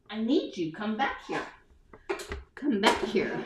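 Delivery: soft pleading to the heating pad; coaxing murmur. Real, not acted. Host coaxing her slipping heating pad back, softly. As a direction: murmur it like calling back a pet.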